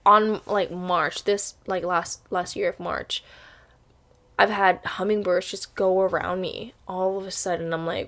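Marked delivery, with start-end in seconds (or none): none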